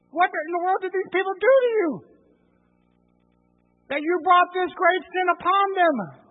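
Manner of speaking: loud, high furious shout at his brother; angry shout. Retold or imitated. Moses yelling at brother Aaron, furious. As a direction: blast it like an enraged older brother. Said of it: "What tone of voice do you think he had with his brother Aaron?".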